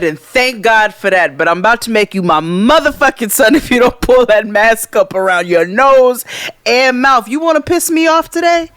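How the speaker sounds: loud, high, escalating retold tirade; full-blown telling-off. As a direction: scold him so the whole hallway hears. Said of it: "so it's full blown everybody".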